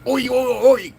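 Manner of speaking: high, loud Australian "Oi!"; playful Australian oi; mock Aussie oi. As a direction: shout "Oi!" in a hammed-up Australian way.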